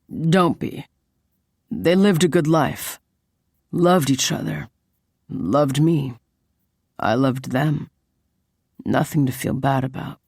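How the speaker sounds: subdued male-lead voice, short phrases; lightly voiced stoic boyfriend. Man remembering his late parents, lightly voiced and subdued.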